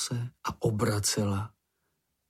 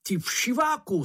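roaring insult; 11 semitones higher than usual. A narrator's reading. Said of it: "zařval Mumínek".

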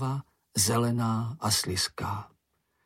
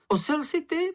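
very high-pitched creature's retort; high cry. A narrator's reading. Tired, hungry creature cries back at a friend, high-pitched. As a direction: cry it out high, worn out and scared. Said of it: "vykřikl Čenich, zmořený únavou, strachem a hladem".

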